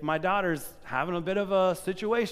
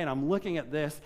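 ordinary talk, nothing marked

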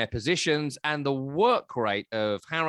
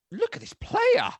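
much higher, slow, put-on awed voice; amazed thought. Watching the substitute, hammed-up awed inner voice. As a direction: marvel at the young player.

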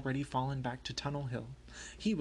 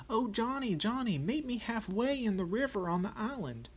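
hallooing out; 9 semitones higher, 3 dB louder than usual. A narrator's reading. Yankee hails a rebel across the river, hallooing. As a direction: call out long and high across a distance. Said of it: "A Yankee hallooed out".

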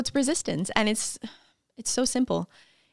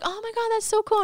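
much higher squealing awe, hammed-up; mock awed squeal; 13 semitones higher than usual. Strangers squealing over her Instagram fame, high mock squeal. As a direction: squeal it like a starstruck fan. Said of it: "people are like".

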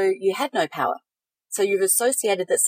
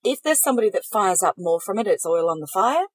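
normal talking voice

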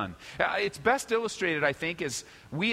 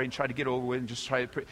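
usual speaking voice

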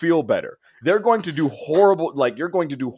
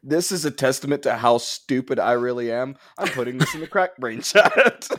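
normal talking voice

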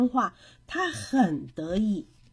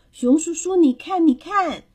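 high, loud, eager call; 6 semitones higher, 8 dB louder than usual. A narrator's reading. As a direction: call across to get someone's attention. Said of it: "他大声地说". (高声喊人来看)

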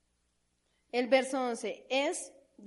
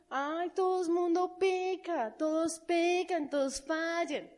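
higher, slow whiny excuse-making; a slow, whiny worldly excuse; mock excuse; 4 semitones higher, slower than usual. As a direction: whine it like someone shrugging off sin.

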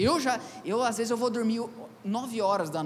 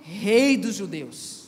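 mocking salute; 4 dB louder, much slower than usual. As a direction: jeer it like a soldier pretending to honour him. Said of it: "o saudavam dizendo". (saudação zombeteira, lenta e alta)

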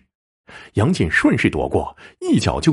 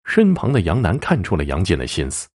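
plain reading voice

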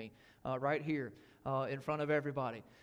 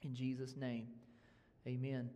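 ordinary talk

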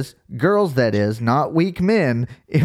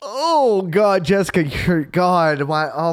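disgusted; 5 semitones higher, slower than usual. Real, not acted. Disgusted reading gross definition.

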